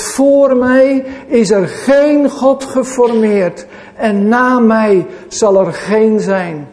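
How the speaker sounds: slow, weighty declaration; God's words through Isaiah, slow and solemn; solemn proclamation. Acted. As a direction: let each word land with authority. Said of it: "Jesaja zegt".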